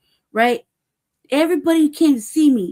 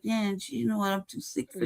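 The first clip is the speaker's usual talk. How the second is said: much softer, worn-out sigh of a line; quiet, exhausted resignation; weary giving up; 3 semitones lower, 10 dB quieter than usual. Retold or imitated. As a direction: say it like you're too drained to fight. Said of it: "and I'm like".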